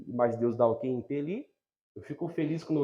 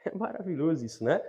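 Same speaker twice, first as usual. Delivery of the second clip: ordinary talk, nothing marked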